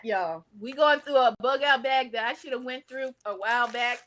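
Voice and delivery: normal talking voice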